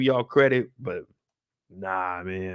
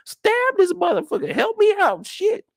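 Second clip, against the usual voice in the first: squeaky high-pitched panic, much higher; shrill girl screaming for help, pitched way up; high panicked Ellie; 3 dB louder than usual. Retold or imitated.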